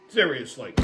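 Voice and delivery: ordinary delivery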